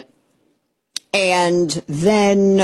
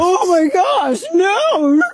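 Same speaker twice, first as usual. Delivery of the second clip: high-pitched, hammed-up shocked outcry; mock horror; 12 semitones higher, much slower than usual. Host mocking critics over a chugged beer, hammed-up horror. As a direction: wail it in exaggerated, scandalized disbelief.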